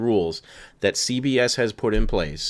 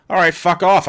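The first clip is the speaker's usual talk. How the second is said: higher and louder, fed-up snap; angry outburst; 8 semitones higher, 5 dB louder than usual. Real, not acted. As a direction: spit it out at the rulebook. Ranting angrily at fan film rules.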